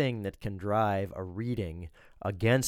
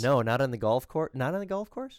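mock guess; 5 semitones higher, 6 dB louder than usual. Joking guess at God's whereabouts.

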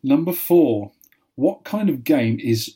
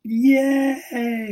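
excited cheer; 12 semitones higher than usual. Real, not acted. Cheers first right answer.

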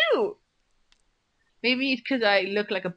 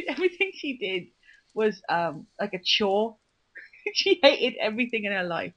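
normal talking voice

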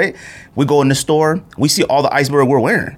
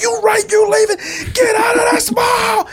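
high-pitched shouting, drawn out at the end; hammed-up shouted eviction, way up high; security guard losing it. Mall security guard kicking someone out, losing his mind. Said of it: "Lost his mind on me".

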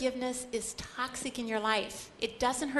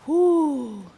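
loud whoo; 3 semitones higher, 8 dB louder than usual. Real, not acted.

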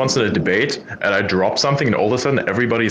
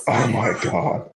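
starship voice effect; much slower than usual. Real, not acted. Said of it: "You sound like you're inside the starship".

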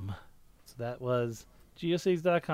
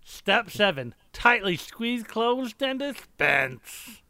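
NPC voice; 7 semitones higher, slower than usual. Acted. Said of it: "my N P C voices".

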